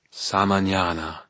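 slow, intoned magic word; spell incantation. Acted. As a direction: chant the spell word slowly.

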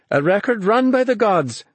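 high, elated exclamation; excited cry. A narrator's reading. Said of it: "she cried".

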